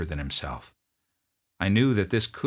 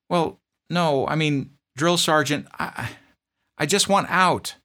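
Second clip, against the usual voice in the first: put-on much higher, strained young voice; pleading private; 10 semitones higher, 7 dB louder than usual. Homesick private begging his drill sergeant, high plea. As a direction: plead like a scared recruit.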